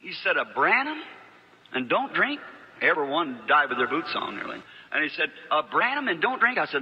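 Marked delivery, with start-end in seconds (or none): 0.4-2.4 disbelieving, rising at BRANHAM and DRINK; halting, questioning disbelief; incredulous question. Acted. Man incredulous at boy refusing drink. 5.5-6.6 higher-pitched disbelief; incredulous question. Acted. Man repeats the incredulous question.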